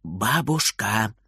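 soft, slow whisper of the grandma's name. A narrator's reading. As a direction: breathe the word quietly. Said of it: "шепчет он". (тихий шёпот)

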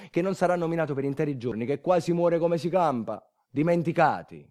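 ordinary delivery

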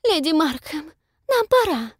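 mock a pompous society lady; pompous Lady Denby tone. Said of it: "в точности копируя напыщенный тон леди Денби".

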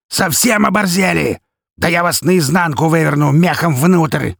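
high, loud hoarse bandit yell; feral wasteland raider. A narrator's reading. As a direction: roar threats like a feral raider, stretching the end. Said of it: "хрипло заорал восседающий за столом жердяй".

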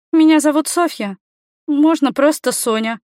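put-on high girlish introduction; young girl. A narrator's reading. Girl introduces herself to the man.

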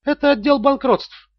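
high, slow official phone voice; bankruptcy department clerk. A narrator's reading. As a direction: state the department slowly, like an operator. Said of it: "услышал он другой голос". (высокий официальный голос служащей)

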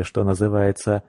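ordinary delivery

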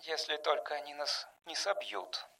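very quiet, under-the-breath worry; hushed, barely voiced aside; muttering. A narrator's reading. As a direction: mutter it almost to yourself. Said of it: "пробормотала Ронда".

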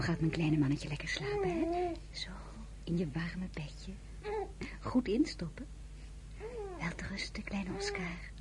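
soft whisper at the cradle; tender whispered goodnight; whisper to a baby. Acted. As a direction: hush the little one to sleep. Tucking the baby into bed.

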